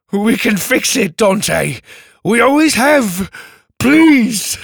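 high, loud, frightened begging; desperate and afraid. Acted. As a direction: plead for your life. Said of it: "desperate and afraid".

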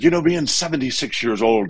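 put-on old Irish priest, Irish accent; Irish brogue. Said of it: "he said it in his beautiful Irish brogue".

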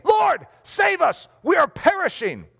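high, slow desperate plea; panicked cry. Acted. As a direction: cry out to be saved from drowning. Disciples crying out in storm.

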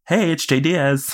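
high, breezy mock greeting; a high, jaunty impression of Che's hello; imitating Che's greeting. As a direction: toss off Che's catchphrase high. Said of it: "did not re-say".

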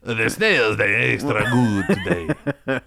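hammed-up Italian waiter; mock Italian waiter. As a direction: show him how a Giuseppe would say it. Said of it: "That doesn't sound right".

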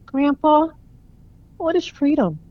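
put-on high, muffled little boy voice; a young grandson asking through tears; child voice. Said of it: "Wesley's muffled voice floated towards him".

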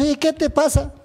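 high-pitched imitated challenge; mock affronted questioning; retold indignation. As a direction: re-enact squaring up to someone, pitch jumping up.

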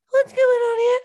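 mock suspicious wife. Wife comes over to the bar, a high, suspicious mock voice. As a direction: play the jealous missus barging in. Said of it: "you come over".

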